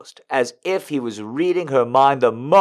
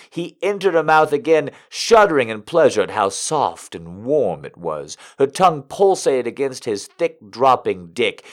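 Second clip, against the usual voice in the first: ordinary delivery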